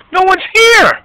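high, loud call across the lot. Real, not acted. Parent calling out that nobody is there, loud. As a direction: shout it so someone answers.

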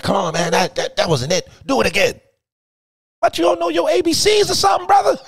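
high, loud director yelling; Stan voice. Retold or imitated. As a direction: bark notes at Big Bird. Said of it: "that's my Stan voice".

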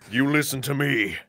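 low, quiet threat; menacing hero. Acted. Hero warning the villain, low menacing voice. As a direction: drop low and soft to warn the villain.

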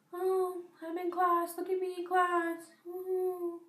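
high, put-on self-mocking voice; mock showing off. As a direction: play-act bragging to the camera in a silly high voice. Mocks filming herself during boring class.

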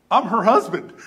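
high, loud proud claim; booming, beaming declaration; proud announcement. Retold or imitated. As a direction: announce it triumphantly.

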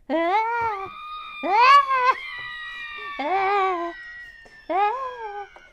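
high-pitched, drawn-out cackle; loud high cackle. Pretend. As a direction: cackle loudly in bursts. Cackle after the jingle.

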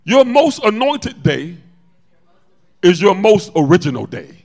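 loud, high, challenging sermon delivery; full-force preaching. Real, not acted. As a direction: hammer it out to the congregation.

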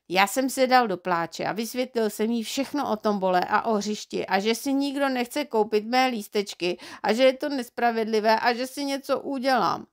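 plain reading voice

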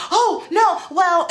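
high, loud mimicry of a flustered client; imitating startled denial; flustered backtracking. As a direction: backpedal in a put-on high voice.

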